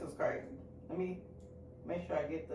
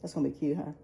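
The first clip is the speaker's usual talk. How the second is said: ordinary talk, nothing marked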